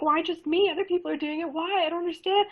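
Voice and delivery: put-on high, whiny panicked teen voice; imitating the scared teen troll begging; panicked teenager. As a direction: mimic a frightened kid pleading. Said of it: "They were a teenager and they were like".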